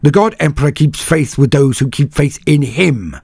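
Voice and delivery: high, loud, firm declaration; resolute. A narrator's reading. Senyavina answers despair with faith, resolute and loud. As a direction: state it with unshakeable faith. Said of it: "Senyavina's voice was resolute".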